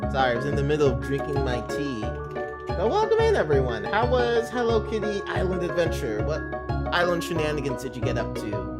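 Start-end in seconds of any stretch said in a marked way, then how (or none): 2.7-6.2 excited greeting. Real, not acted. Streamer greeting, high pitched.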